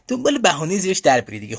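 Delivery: ordinary delivery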